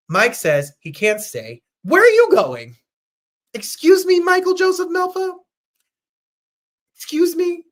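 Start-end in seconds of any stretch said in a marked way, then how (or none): 1.8-7.6 high, loud put-on diva voice, hammed-up; mock diva outrage. Host scolds departing Mike in a high, hammed-up diva voice. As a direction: play the offended diva calling him out.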